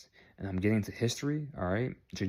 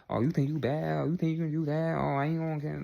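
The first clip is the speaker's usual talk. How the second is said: higher-pitched mock tough talk; 6 semitones higher than usual. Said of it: "You have to talk this way".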